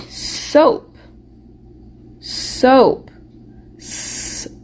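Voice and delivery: "Soap" then "S-ope" split into s and ope, breathy; segmenting a word. Shown as a demonstration. Teacher breaking soap into sounds for the class. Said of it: "Is that a long O sound".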